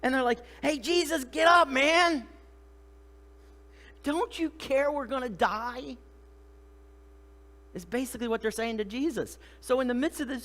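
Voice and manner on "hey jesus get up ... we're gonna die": high, loud, drawn-out desperate plea; hammed-up frantic appeal. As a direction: act like panicked men rousing a sleeper. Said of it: "okay, they're desperate".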